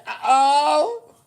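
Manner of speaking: high, loud surprised uh-oh. Retold or imitated. Finding her old account on the phone, shrieking surprise. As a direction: squeal it in mock alarm. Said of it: "I said".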